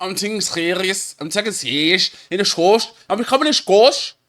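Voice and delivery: imitating a thick Scouse accent; mock Scouse. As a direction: parrot the Scouse speaker, pitch wandering upward. Mimics the clip's Scouse accent.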